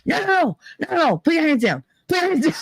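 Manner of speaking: high, hurried mother's plea to stop; mother's urgent pleading. Retold or imitated. Mother at the wake urging daughter to stand down, high and pleading. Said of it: "My mother's going".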